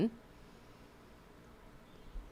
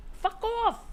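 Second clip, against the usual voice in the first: exasperated squeal at a fly; 13 semitones higher, 4 dB quieter than usual. Real, not acted. Swatting at the fruit fly.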